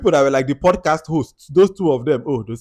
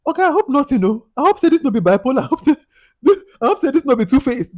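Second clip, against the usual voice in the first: Nigerian Pidgin, much higher and louder, exasperated; Pidgin exasperation; 14 semitones higher, 8 dB louder than usual. Real, not acted. Vlogger mocking the husband in Nigerian Pidgin, high and loud. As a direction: Pidgin aside, high and fed up.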